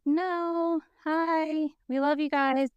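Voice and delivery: high, sugary fake-friendly reply; sing-song innocent brush-off; mock sweet. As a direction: chirp it back falsely nice. Cast mocking sweet reply.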